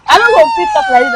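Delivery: loud, high crying wail. Acted. Distraught woman wails to her compound, loud crying wail. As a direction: wail it out, near to tears. Distressed madwoman wailing to stranger.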